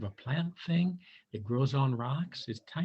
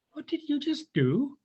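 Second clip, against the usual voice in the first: high, bright little bird asking; hummingbird voice; 12 semitones higher, 3 dB louder than usual. A narrator's reading. Bird asks the turtle, high bird voice. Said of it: "asked the bird".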